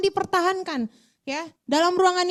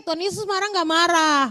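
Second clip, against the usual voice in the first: usual speaking voice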